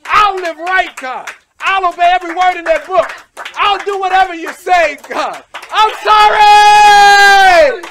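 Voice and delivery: a wailing plea for forgiveness, building to a long drawn-out cry; shouted repentance prayer. Acted. Preacher models the sinner's prayer for the congregation, shouting. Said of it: "all you have to do is say".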